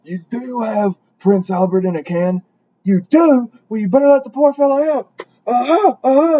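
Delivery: loud, high-pitched clown taunt; comedic horror clown. Acted. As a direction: build to a hammed-up, high shout. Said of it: "He was like".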